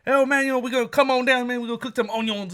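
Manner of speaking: high, run-together Louisiana cook impression; Boomhauer-style Louisiana patter; Boomhauer-like Louisiana drawl. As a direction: mimic a mumbly Louisiana cook. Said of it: "It was like a Boomhauer voice".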